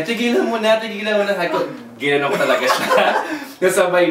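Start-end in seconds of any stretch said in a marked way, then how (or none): 0.0-1.4 higher, louder mock plea from the bully girl; retelling a schoolyard fight, imitating the girl's higher, louder plea; girl begging to stop. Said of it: "sabi niya".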